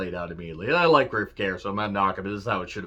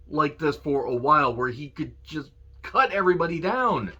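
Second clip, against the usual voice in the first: excited pleading; 8 semitones higher than usual. Real, not acted. Fan begging for more MJF.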